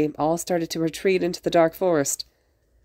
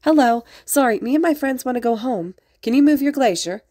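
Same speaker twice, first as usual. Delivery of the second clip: put-on high, louder, childlike polite call; 8 semitones higher, 4 dB louder than usual. A narrator's reading. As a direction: call cheerily to someone below. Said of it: "Izuku called down to him".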